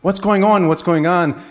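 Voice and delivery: a driver shaken after near misses, calling out alarmed; alarmed question. Retold or imitated. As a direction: cry out what's happening in alarm. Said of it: "and says".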